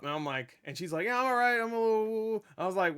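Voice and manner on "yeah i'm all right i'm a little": high, woozy mock voice; wobbly imitation of someone hurt getting up; woozy woman. Hurt aunt insisting she is fine, woozy. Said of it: "she wait, she gets up".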